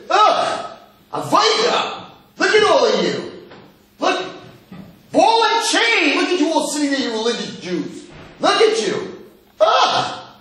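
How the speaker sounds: very high, hammed-up scornful jeering; loud mock disgust. Guy walking into an engagement party jeering, theatrical disgust. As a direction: heckle them with exaggerated revulsion.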